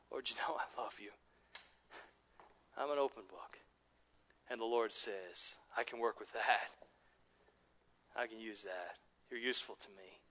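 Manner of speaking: hushed tender voicing. Preacher voicing Peter and the Lord, hushed and tender. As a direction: speak it like a confession heard in private.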